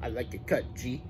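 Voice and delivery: low, soft mock meme voice; a quiet hammed-up meme line. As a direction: mumble it like the Sid meme. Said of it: "picture someone doing this meme".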